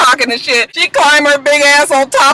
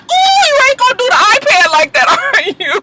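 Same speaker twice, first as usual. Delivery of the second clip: mock disbelief; 9 semitones higher than usual. Reacting to the iPad on TV.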